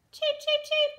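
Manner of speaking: high chick cheeps; chick peeps. Acted. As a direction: peep high like baby chicks. Chicks counted, peeping.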